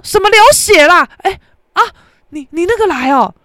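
high, loud, caught-off-guard imitation of him; mock his alarmed reaction; startled reply. Boyfriend startled by her news.

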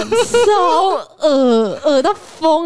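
drawn-out high disgusted whine; disgusted groan. Real, not acted.

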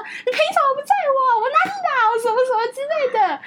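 half laughing, half furious, pitch climbing; laughing-crying anger. Retold or imitated. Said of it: "笑到哭、气到哭的在那边讲说".

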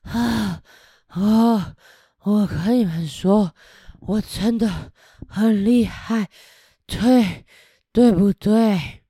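tired dragon gasping out words, slowing down; panting dragon. Acted. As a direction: still bragging while out of breath. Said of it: "一边喘着气说". (喘着气的喷火龙)

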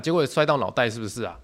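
normal talking voice

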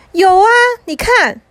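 put-on high, emphatic child's protest; insistent little brother. Little brother contradicting mom, high and insistent. 弟弟反驳妈妈.

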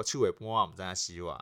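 saying the slang in Taiwanese Hokkien. Shown as a demonstration. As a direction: Taiwanese Hokkien proverb, clearly recited. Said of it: "所以我们会说".

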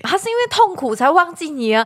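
ordinary delivery

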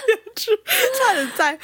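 a very high laugh trailing into the words. Real, not acted. Recalling her parents feeding the boyfriend, laughing hard. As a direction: burst out laughing, then squeeze out the words.

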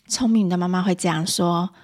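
ordinary talk

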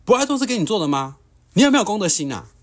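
shrill-pitched retold yell of a nosy elder; furious scolding. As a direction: scold him like an outraged auntie on the train. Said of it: "拿着雨伞指着我骂说".

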